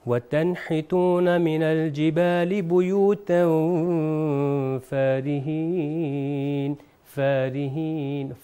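recited demo with a thin faa in farihin; normal, light faa. Shown as a demonstration. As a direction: model farihin, keeping the faa unthickened. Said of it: "تو یہ ہوگا".

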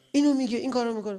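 high, loud, rapid-fire mock griping; imitated irritated complaint. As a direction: rattle off a spouse's grievances in imitation. (ادای غر زدن عصبی، بلند و تند)

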